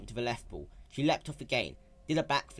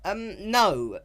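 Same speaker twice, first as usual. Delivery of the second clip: stuttered; 10 semitones higher, 9 dB louder than usual. Acted. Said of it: "Spike stuttered".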